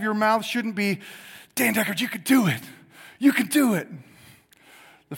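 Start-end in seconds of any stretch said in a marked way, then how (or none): 1.6-3.9 raised-pitch self-encouragement; mock pep talk. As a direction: cheer yourself on, acted out.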